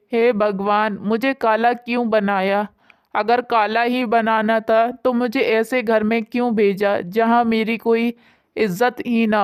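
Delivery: usual speaking voice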